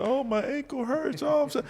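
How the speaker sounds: mock complaining in a high whimper; whiny soldier. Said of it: "are you gonna sit there".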